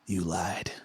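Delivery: harsh whispered accusation; eerie rasping whisper; possessed blade's voice. Acted. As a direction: hiss it as a haunted blade. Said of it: "you h-, hear the voice say".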